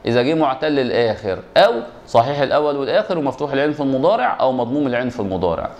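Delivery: ordinary talk, nothing marked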